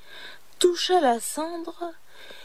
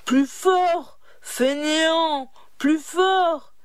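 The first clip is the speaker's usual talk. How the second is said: shout; 4 semitones higher, slower than usual. A narrator's reading. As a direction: drive the lazy cooks harder. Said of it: "en criant".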